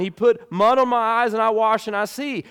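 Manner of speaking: exasperated, tired of repeating himself; frustrated. Retold or imitated. Healed blind man to Pharisees, exasperated repeating. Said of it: "you can kinda sense frustration".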